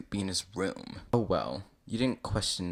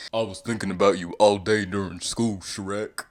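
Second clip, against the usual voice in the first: louder, put-on character voice; lightly voiced lovestruck visitor; 7 dB louder than usual. You greeting Shrek after school, lovestruck. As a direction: declare it openly to a sweetheart.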